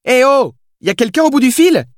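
high-pitched hailing of someone dazed; calling out. A narrator's reading. Billy tries to rouse the dazed writer, calling out. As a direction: call out in a high voice for attention. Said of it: "m'interpella Billy en agitant les bras".

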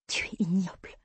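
whispered disgust, no voice; hissed insult. Acted. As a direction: spit it out under your breath. Heroine hisses insult at Ben. (insulte chuchotée avec dégoût)

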